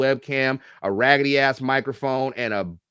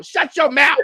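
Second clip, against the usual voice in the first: much higher, louder mock scold; scolding himself after a naughty joke, mock shout; mock self-scold; 14 semitones higher, 7 dB louder than usual. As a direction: snap at yourself playfully. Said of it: "scolding himself after joke".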